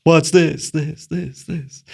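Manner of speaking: mimicked echo. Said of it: "turn off the echo".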